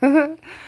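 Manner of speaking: high loud cackling laugh; mock Janice laugh. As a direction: imitate a sitcom cackle. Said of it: "Janice from Friends".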